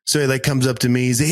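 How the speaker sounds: usual speaking voice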